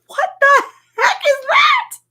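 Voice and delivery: high, slow amazed cry; shocked disbelief. Real, not acted. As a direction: gasp out the disbelief. Shocked at cashew picture.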